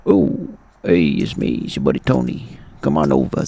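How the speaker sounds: very low, laid-back character voice; Tony. Pretend. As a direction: drop right down for a cartoon buddy.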